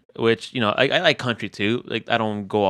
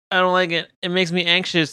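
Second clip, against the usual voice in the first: high wife voice; 8 semitones higher than usual. Retold or imitated. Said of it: "she's like".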